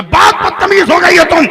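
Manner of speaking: scolding. Acted. Father-in-law scolds bride.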